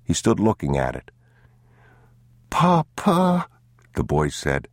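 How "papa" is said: put-on high, small child's voice; a scared young boy tugging at his father; boy's voice. A narrator's reading.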